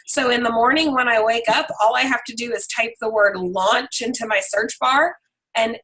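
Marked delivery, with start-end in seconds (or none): none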